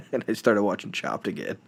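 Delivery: whispered sheepish confession; host admitting he switched shows, whispered. Real, not acted. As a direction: let it slip out in a whisper.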